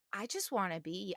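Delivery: soft, longing murmur; dreamy sigh. Retold or imitated. Envying a charming French girl, softly sighed. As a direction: breathe it out like a starstruck fan.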